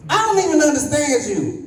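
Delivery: high laughing yell of confusion; laughing shout. Retold or imitated. To the cop who pulled him over, a high laughing shout. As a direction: blurt it out high, half laughing.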